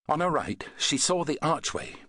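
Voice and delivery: ordinary delivery, nothing marked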